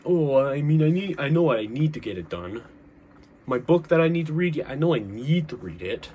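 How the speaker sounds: a lightly put-on New York accent, played for laughs. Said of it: "transitioned to a New York accent there".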